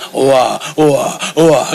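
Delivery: low, short wah-wah bursts; mock low-pitched crying, evenly repeated; imitated baby cry. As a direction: cry in small low wahs like a father. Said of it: "anak saya masih kayak bapaknya".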